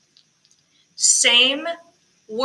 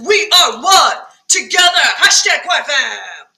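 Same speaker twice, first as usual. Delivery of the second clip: big, high declamation; forte shout; 3 semitones higher, 5 dB louder than usual. Shown as a demonstration. Teacher showing choir what forte means, loud and emphatic. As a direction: belt it out with full force. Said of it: "this is supposed to be forte".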